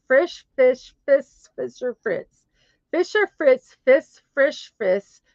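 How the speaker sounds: slow, high, word-by-word recital; careful tongue twister. Shown as a demonstration. Streamer retrying a German tongue twister, careful and slow. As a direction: work slowly through each tricky German word.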